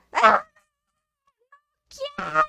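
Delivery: very high imitated child's squeal of refusal; niño negándose. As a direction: squeal it like a protesting little boy. Niño se niega a comer. (chillido agudo de niño berrinchudo)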